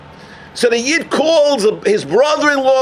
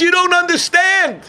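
urgent outburst; 8 semitones higher than usual. Retold or imitated. Friend insists to the rabbi he missed the point, urgent raised cry. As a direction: blurt it out to stop him short.

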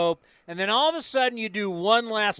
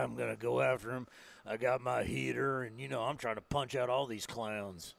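much lower, softer gunfighter voice; low menacing gunslinger; 7 semitones lower, 11 dB quieter than usual. Pretend. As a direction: growl it like an old Western gunfighter. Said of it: "I don't think he sounds like that".

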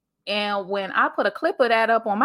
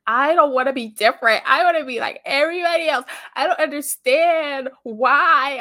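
put-on high, louder teenage whine, slowing at the end; whining; 6 semitones higher, 6 dB louder than usual. Teen complaining to her family, a high whine. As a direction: whine like a frustrated sixteen-year-old. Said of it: "listen, whining".

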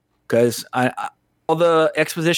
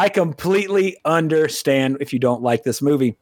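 normal talking voice

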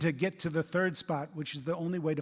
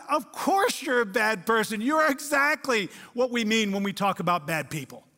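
louder, higher yelling at the TV; retold incredulous yell; 4 semitones higher, 3 dB louder than usual. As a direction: yell back at the screen in disbelief. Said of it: "I yelled at the T V".

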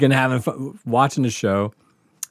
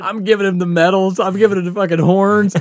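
excited yelling; 8 semitones higher, 5 dB louder than usual. Retold or imitated. Rocking out at the stage.

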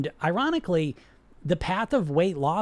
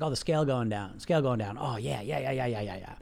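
blissful dieter; 7 semitones lower, 6 dB quieter than usual. Retold or imitated. Dieter enjoying scale dropping.

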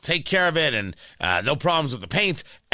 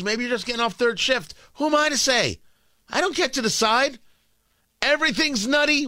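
rising excitement; 7 semitones higher than usual. Real, not acted. Building rant about judging.

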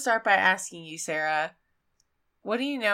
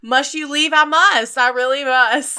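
higher and louder, theatrical parting; mock melodramatic farewell; 5 semitones higher, 7 dB louder than usual. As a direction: play a tragic goodbye scene.

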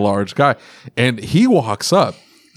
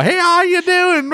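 much higher cheery hello; friendly big greeting. Retold or imitated. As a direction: greet your critic with breezy friendliness. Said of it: "he walks up with a big old".

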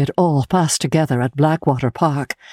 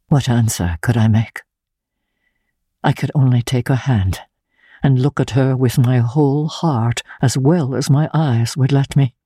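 ordinary delivery

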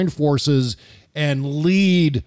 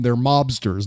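usual speaking voice

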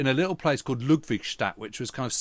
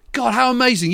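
amazed thought; 10 semitones higher, 7 dB louder than usual. Retold or imitated. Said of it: "I thought".

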